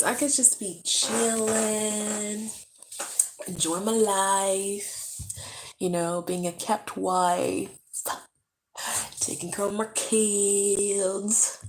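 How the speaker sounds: slow, drawn-out sing-song; playful daydream. Pretend. Vlogger imagining life as a kept wife, playful slow drawl. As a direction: stretch each last word high and long.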